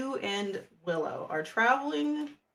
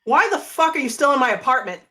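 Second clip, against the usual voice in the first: loud, raised-pitch demand; angry yell; 5 semitones higher, 9 dB louder than usual. Acted. Ezra storms through the door at Millie, angry yell. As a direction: yell it at an unwanted intruder. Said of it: "and he is angry".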